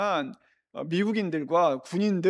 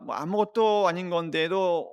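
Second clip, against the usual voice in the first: usual speaking voice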